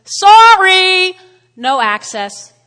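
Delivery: loud, high, drawn-out sorry, then a firm drop; mock rejection called out big; God refusing entry. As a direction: play God turning someone away loudly. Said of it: "So God says".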